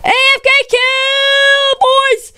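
high, slow triumphant yell; excited shout. Real, not acted. Gamer yelling to the boys after a kill, triumphant shout. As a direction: shout it out to the team in victory.

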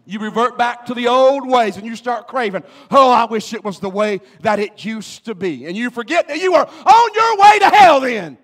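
loud sermon climbing to a high shout; full-force preaching. Real, not acted. Preacher warns backsliders they are headed for hell, full-force preaching. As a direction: build from loud exhortation to an all-out cry.

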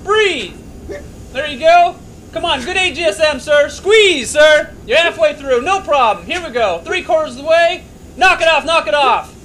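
loud, high urgent commands; shouting coach. Real, not acted. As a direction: drive him hard over the noise. Coach shouting during g-force run.

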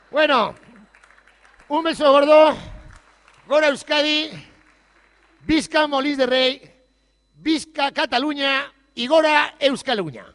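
loud, high, slow rallying cries; slow shouted farewell cheers. Real, not acted. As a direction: cheer them out at full voice. Closing cheers to the crowd.

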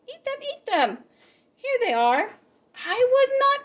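ordinary delivery, nothing marked

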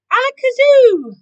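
very loud, high spell with a long last syllable; magician's incantation. A narrator's reading. Keith trying another spell, loudly proclaiming it. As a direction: belt out the magic word.

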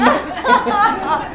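high burst of laughter; high-pitched giggle; laughing. Real, not acted. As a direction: crack up after telling the story. Laughs after own impression.

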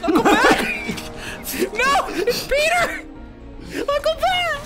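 high, loud cries of excitement; excited shout. Acted. Overjoyed call to Uncle Ben.

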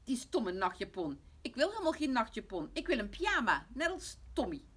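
put-on loud, high-pitched child's whining; tearful tantrum. Lotje starts crying about her nightgown, loud tearful tantrum. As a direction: protest like an upset little girl. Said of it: "Ook Lotje begint te huilen".

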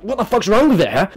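lightly voiced irritated mum; a hint of a snapping mother; mock mum. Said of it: "She's like".

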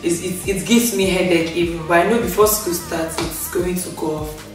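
usual speaking voice

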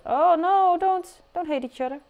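high, pleading oh no; dismayed plea. Real, not acted. Dismay at Sims fighting.

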